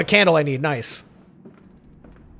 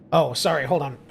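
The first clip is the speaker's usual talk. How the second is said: ordinary delivery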